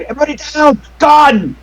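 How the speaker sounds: high, loud warning yell; warning shout. Acted. GM voicing the player's warning as the car screeches up. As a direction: order everyone to the floor. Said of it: "you said".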